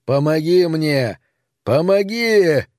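slow, high, drawn-out call; stretched-out desperate wail of words; cry for help. A narrator's reading. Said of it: "закричал Пит Сатт".

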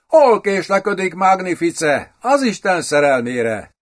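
magasabb, izgatott sürgetés; sürgesd izgatottan, felemelt hangfekvésben; izgatott kancellista. A narrator's reading. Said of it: "izgult egy kancellista".

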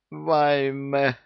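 high moaning cry of despair; groaning wail. A narrator's reading. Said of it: "простонал Саид".

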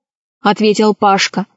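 usual speaking voice

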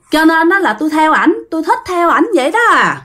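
put-on coquettish girl. Ghost girl confessing she follows the handsome guy, coy and high. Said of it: "theo kiểu cái con gái mà điệu á".

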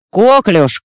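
Коклюш called loud over a crackling radio; radio static. Acted. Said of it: "Сквозь помехи в эфире".

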